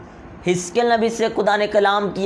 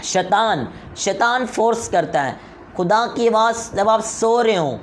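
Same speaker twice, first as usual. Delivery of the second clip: normal talking voice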